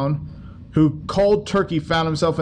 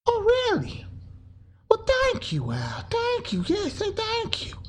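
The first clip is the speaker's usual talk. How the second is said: much higher, softer, meek thank-you; falsetto grateful worker; 6 dB quieter, slower than usual. Acted. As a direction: squeak your thanks to the boss.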